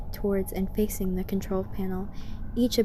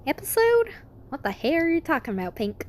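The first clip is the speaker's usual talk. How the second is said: confused outburst; 7 semitones higher than usual. Acted. Applejack confused.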